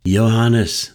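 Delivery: the name snapped out loud and high, dropping at the end; an old mentor's stern, loud rebuke; sharp retort. A narrator's reading. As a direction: cut him off by calling Johannes sharply. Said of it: "the old man retorted".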